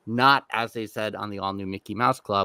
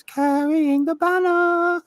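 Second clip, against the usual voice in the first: high, slow, hammed-up New York "banner" sung out like a newsboy; mock Broadway New York belt; New York accent; 4 dB louder, slower than usual. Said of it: "the eight-year-old had to learn how to sing Carrying the Banner".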